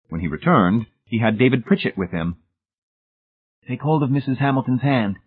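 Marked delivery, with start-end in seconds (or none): 3.7-5.2 higher-pitched, measured command; instructing. A narrator's reading. Rescuer directing the boy at the stairs, instructing. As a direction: give the instruction firmly without raising volume. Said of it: "he instructed the boy".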